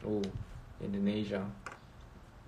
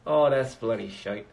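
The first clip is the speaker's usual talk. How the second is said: louder, hammed-up Irish "Oh, that's bloody shite"; mock Irish accent; 5 dB louder than usual. Vlogger laughing at own Irish attempt, louder mock Irish. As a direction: belt it out as a cheeky Irish imitation. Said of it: "The Irish accent is".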